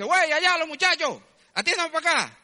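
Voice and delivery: loud, high call across the street; a man in a jeep hollering at the young men; calling out loudly. Retold or imitated. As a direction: shout over to the domino players.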